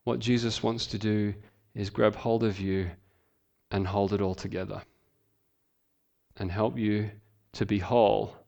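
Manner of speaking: tender. Real, not acted. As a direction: gently comfort a hurting listener. Tender appeal to congregation.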